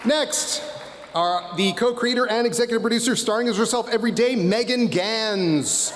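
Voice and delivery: booming ring announcer, name stretched out; pro wrestler announcer voice. Acted. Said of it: "my pro wrestler announcer voice".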